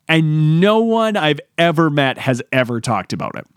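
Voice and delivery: ordinary talk, nothing marked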